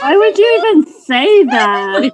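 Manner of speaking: loud, horrified outburst; shocked yelling protest; appalled shout. Real, not acted. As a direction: yell it in disbelief at a friend. Appalled by Hulk sex remark.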